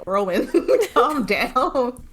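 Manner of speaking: slow, laughing "calm down"; laughing plea. Real, not acted. Laughing at the paranoid nanny, slow and amused. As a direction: plead through real laughter.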